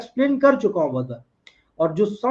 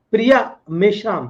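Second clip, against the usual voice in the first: ordinary talk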